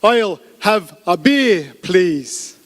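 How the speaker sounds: exaggeratedly slow, word by word; really slow speech. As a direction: leave a gap after every word. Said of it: "just speak really slowly".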